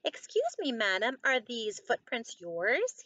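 lightly acted cheerful storybook question. A narrator's reading. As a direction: pipe up cheerfully on "Excuse me". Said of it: "he asked her cheerfully".

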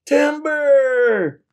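very high, loud drawn-out call; lumberjack's shout. A narrator's reading. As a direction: call out a warning as the tree falls. Warning call as tree falls.

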